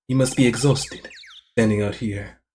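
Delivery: soft, low, seductive murmur; sexy suitor. Acted. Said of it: "it has to be sexy".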